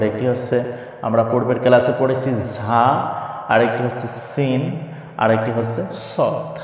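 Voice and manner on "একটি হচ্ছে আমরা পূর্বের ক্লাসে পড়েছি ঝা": usual speaking voice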